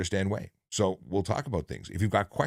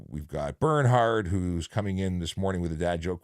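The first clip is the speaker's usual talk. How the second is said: normal talking voice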